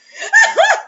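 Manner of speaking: high, loud cackle; loud burst of laughter. Real, not acted.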